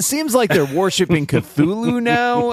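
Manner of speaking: slow, high, eerie mock doom; hint of a spooky cultist; ominous cult voice. Joke about elder god cult.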